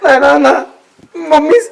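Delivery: distraught tearful wail; agitated crying. Acted. Heartbroken man rejecting Nana's reassurance, crying in agitation. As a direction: cry out, refusing to be calmed. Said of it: "शांत हो आधी तू".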